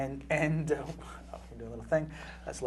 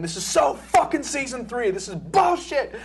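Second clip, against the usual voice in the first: ranting yell; 9 semitones higher than usual. Retold or imitated. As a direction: storm around the set yelling. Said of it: "swearing and yelling and saying".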